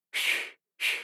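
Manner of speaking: whispered hush to calm him; soothing shush. A narrator's reading. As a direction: shush him like a crying child. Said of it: "her hands on his forehead".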